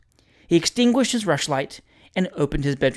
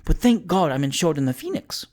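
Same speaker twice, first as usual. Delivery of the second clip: plain reading voice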